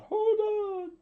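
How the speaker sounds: high-pitched scream from a crowd; screamed cry. A narrator's reading. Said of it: "someone screamed out".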